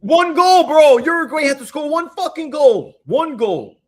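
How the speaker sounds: loud, high exasperated yell about one goal; frustrated shout. Real, not acted. Ranting to friends about a lost bet, loud and exasperated. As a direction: vent it at full volume, disbelieving.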